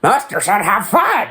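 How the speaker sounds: put-on high, slow, gleeful kid cheer; gleeful childish voice. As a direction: chant it like happy kids. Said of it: "and so they just".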